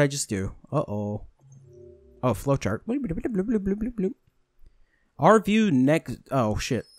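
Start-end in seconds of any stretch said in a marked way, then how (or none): 2.9-4.1 high, soft bubbly bloops; playful imitated computer noise; imitated blooping. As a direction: burble a string of little high bloops. Imitates flowchart noise while scrolling.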